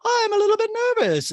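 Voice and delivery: high, loud nervous lady; Dorit impression. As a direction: play Dorit jittery.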